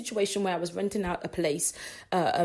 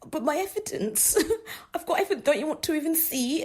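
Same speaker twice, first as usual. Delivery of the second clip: laughing plea; 7 semitones higher than usual. Retold or imitated. Pleading about her evidence.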